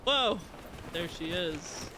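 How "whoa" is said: startled whoa. Real, not acted. Sudden sight in game.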